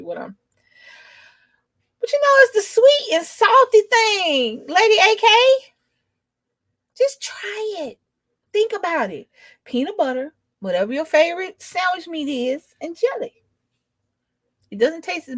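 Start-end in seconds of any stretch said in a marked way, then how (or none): 2.0-13.4 coaxing, pleading. Real, not acted. Coaxing chat viewer to try it.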